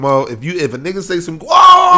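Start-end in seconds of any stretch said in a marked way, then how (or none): 1.4-2.0 very high, loud alarmed whoa; shocked whoa. Shown as a demonstration. Host showing how he reacts to a crude remark, shocked shout. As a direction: call it out like you caught a slip. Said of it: "that's the first thing I say when".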